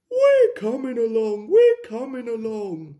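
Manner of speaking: high, loud singsong reply; lilting high answer, called out; singsong carriages. A narrator's reading. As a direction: chant it in little squeaky voices. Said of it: "sang Annie and Clarabel".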